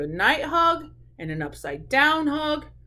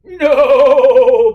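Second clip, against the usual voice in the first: loud, wobbling baa of refusal; bleating sheep; 16 dB louder than usual. Acted. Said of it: "bad the sheep".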